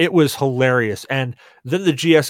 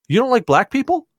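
high, rising accusing question; mock-serious challenge; 11 semitones higher than usual. Bigwig confronting the Millennial Falcon, imitated serious challenge. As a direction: put him on the spot, mock-serious. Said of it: "this super serious face on him".

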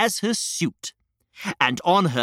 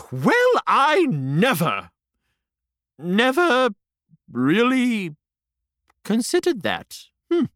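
flustered queen; 5 semitones higher than usual. Acted.

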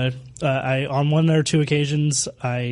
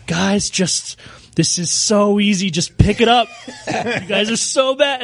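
raised, higher voice of mock exasperation; imitated frustration; 5 semitones higher than usual. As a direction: mimic a teammate with rage building. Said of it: "I could hear the frustration in your voice".

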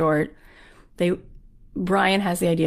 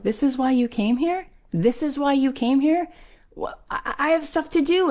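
higher-pitched, baffled repeated question; incredulous; 9 semitones higher than usual. Retold or imitated. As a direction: act out a busy man's disbelief. Baffled director questions the visitor.

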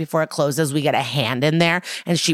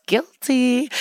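smirking; 6 semitones higher than usual. Retold or imitated. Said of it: "Barbara smirks a little and goes".

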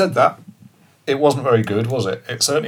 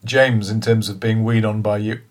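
ordinary talk

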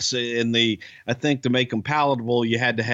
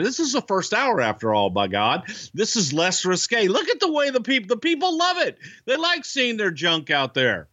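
much higher, hammed-up mock indignation; mock outrage; 10 semitones higher than usual. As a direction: play the prude, high and scandalised. Mock-indignant joke about the censors.

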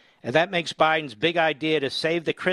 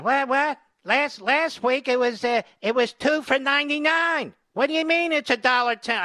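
high, put-on voice of a customer shocked at the price; outraged customer; 11 semitones higher than usual. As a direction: squeal with disbelief at a dollar ten. Outraged customer over donut price.

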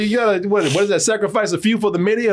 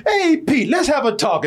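raised sweet friendly tone; a mock-nice invitation, pitched up; 7 semitones higher than usual. Retelling Mister Gray's friendly offer, high and sweet. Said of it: "He said".

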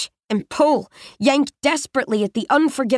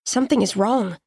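ordinary delivery, nothing marked

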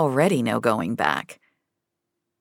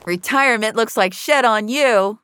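higher, raised-voice jibe; teasing call-out; 8 semitones higher, 4 dB louder than usual. A narrator's reading. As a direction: call it out to an old friend. Calling out a teasing greeting.

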